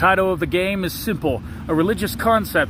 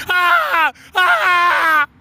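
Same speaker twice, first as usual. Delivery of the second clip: high, loud drawn-out scream; 12 semitones higher, 6 dB louder than usual. Acted. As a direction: scream "Ah!" in long, high bursts. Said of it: "just screaming, going".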